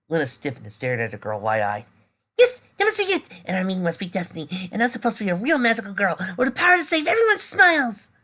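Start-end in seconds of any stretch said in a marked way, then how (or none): 2.4-8.1 very high, loud gushing; giddy excitement. Acted. Girl gushing to the cat about destiny. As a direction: gush like a magical girl fan.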